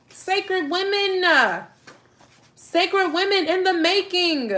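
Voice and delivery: high, slow, each word stretched; slow high proclamation; drawn-out emphasis. Real, not acted. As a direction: lift them up, slow and deliberate.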